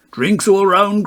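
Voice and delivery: high, loud call for drinks; cried out. A narrator's reading. Bob the Fiddle crying drinks round to the bar, loud and high. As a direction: cry it out over the pub noise. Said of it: "cried Bob the Fiddle".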